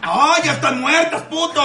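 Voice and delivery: high, drawn-out exasperated cry; mock exasperation. Moviegoer scolding people who chew popcorn too noisily, exasperated. Yelling at noisy popcorn eaters.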